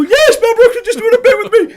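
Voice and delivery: very high, loud, overjoyed confirmation; hammed-up ecstatic answer; elated yell. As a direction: shout it in giddy triumph. Retells his elated answer.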